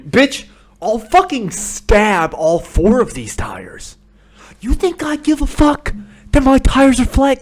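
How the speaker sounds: high, loud acted tirade; furious rant. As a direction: scream threats at a meddling stranger. Acted furious rant at old lady.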